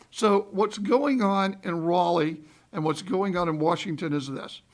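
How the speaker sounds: usual speaking voice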